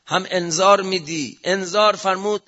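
usual speaking voice

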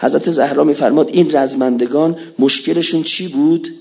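normal talking voice

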